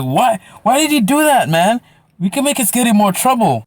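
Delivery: high-pitched mock dismay; exasperated protest. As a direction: re-enact an incredulous complaint, voice jumping up. Protests friend's taunt before police.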